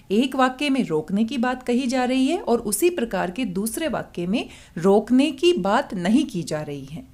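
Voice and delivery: ordinary talk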